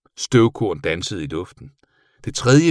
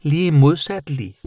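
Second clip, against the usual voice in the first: high, gleeful creature voice; happy bat; 9 semitones higher, 5 dB louder, slower than usual. A narrator's reading. Bat correcting her worried friend, happily high-pitched. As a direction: exclaim it joyfully, high-pitched. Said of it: "Hun lød overrasket og virkede pludselig rigtig glad".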